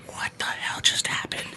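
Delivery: retold disbelief, all in a whisper; hushed, voiceless astonishment; stunned whisper. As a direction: whisper it as if dumbfounded. Retold stunned reaction to bee rescue.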